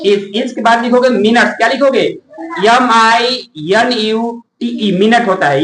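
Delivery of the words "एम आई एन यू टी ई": slow spelling. Shown as a demonstration. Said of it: "spelling minute for students".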